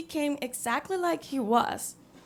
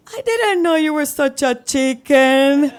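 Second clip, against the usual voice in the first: laughing tease; 2 semitones higher, 9 dB louder than usual. Retold or imitated. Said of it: "and starts laughing".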